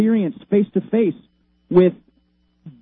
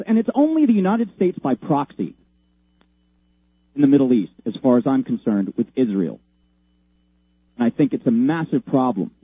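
normal talking voice

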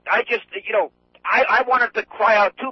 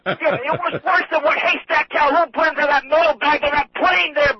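ordinary talk, nothing marked

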